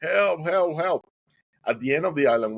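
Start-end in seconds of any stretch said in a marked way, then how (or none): 0.0-1.0 high, mildly raised cry for help; retold screaming. Swimmer in trouble, screaming for help. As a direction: imitate calling out for rescue. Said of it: "I started screaming".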